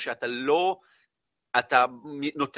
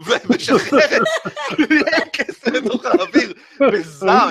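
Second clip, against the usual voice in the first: laughing; 9 semitones higher, 10 dB louder, slower than usual. Real, not acted. GM laughing through the narration.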